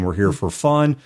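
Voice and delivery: ordinary delivery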